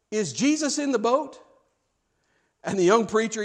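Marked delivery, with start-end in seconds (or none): 0.1-1.4 higher, more emphatic question; insistent repeat. Retold or imitated. Jim repeating himself to the baffled preacher, insistent. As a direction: press the question home, rising at the end.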